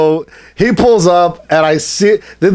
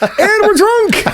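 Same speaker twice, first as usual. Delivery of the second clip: high-pitched, drawn-out laughing words; laughing admission; slower than usual. Real, not acted. Friend adds to shared late-night memory, laughing. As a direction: squeal it through real laughter.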